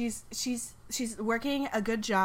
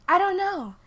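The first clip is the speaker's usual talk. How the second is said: Sally voice; 6 semitones higher, 4 dB louder than usual. Acted.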